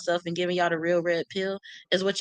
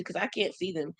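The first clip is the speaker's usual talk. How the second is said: normal talking voice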